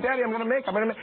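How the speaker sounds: high little-girl whine, imitated; toddler voice. Dad imitating his toddler daughter in the car. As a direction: play the potty-desperate toddler. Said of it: "and she's going".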